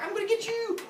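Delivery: high, taunting play voice; playful chasing brother. Acted. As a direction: taunt your brother as you chase him.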